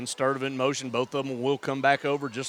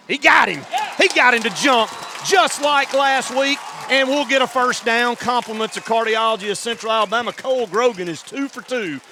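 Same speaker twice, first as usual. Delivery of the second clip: high, loud triumphant shout; excited shout; 7 semitones higher, 6 dB louder than usual. Real, not acted. Play-by-play caller to home fans celebrating a first down, excited shout. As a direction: yell it like the defense just jumped. Offsides drawn, first down call.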